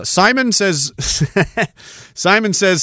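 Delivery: usual speaking voice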